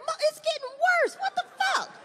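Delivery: father protesting to his daughter; high exasperated whine. Retold or imitated. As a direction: whine in disbelief.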